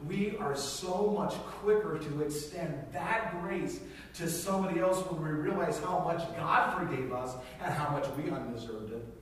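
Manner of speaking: ordinary talk, nothing marked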